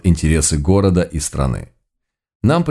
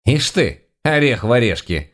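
lightly voiced old woman, higher pitch; a small higher-pitched granny scoff; 5 semitones higher than usual. A narrator's reading. Granny at the fenced gate, scoffing. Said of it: "хмыкнула бабка".

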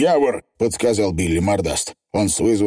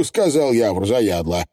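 normal talking voice

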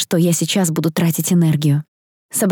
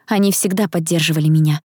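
ordinary delivery

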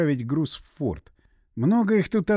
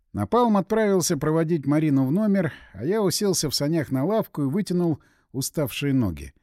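plain reading voice

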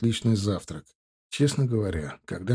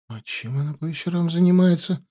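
higher-pitched, uneasy question; lightly voiced tense query, pitched up; 7 semitones higher than usual. A narrator's reading. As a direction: ask it with a touch of tension. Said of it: "спросила Вета с напряжённым видом".

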